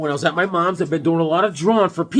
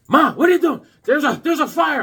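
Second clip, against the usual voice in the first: higher, louder panicked yell; alarmed shout; 9 semitones higher, 5 dB louder than usual. Retold or imitated. Son shouting to his mother during a fake fire. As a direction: call out in alarm at night. Yelling at mom at night.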